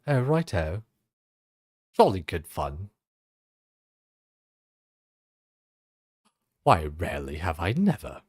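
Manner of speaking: mock posh English with swooping intonation; posh British accent. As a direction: put on a plummy upper-class British accent. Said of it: "Some posh chick accent".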